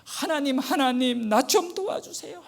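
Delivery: a hint of desperate wailing; retold crying prayer. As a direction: cry out to God, half-recreating the sobbing. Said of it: "혼자 소리 지르면서 이제 엉엉 울기".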